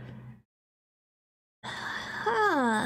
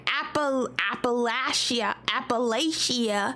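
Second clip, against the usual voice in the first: Appalachia said lower and slow, Appa-LATCH-ia; Appalachian pronunciation; 6 semitones lower, slower than usual. Shown as a demonstration. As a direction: try it the local way, slow. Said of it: "how do they say it in Appalachia".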